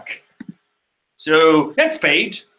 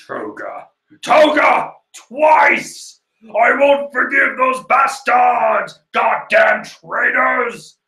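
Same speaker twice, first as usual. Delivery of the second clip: furious shout; 9 semitones higher, much slower than usual. Retold or imitated. Irinaka screaming at the traitors, high furious yell.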